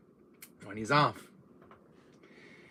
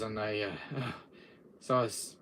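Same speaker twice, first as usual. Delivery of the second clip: usual speaking voice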